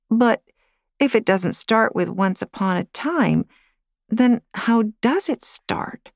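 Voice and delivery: put-on a small girl pressing for an answer; puzzled little girl. Girl questions how story starts.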